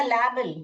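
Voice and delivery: लेबल misread as LAB-ul; short "a" of "lab" in लेबल; wrong short-vowel reading. Shown as a demonstration. Teacher shows what a wrong division gives, short-vowel misreading. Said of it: "तो ये गलत होगा".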